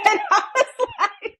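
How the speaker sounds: high burst of laughter through the words; laughing. Real, not acted. Laughing at the driver's reaction.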